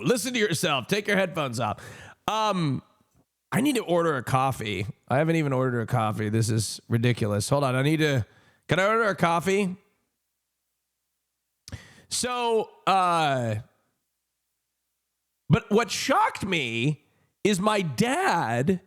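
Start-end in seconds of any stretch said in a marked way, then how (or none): none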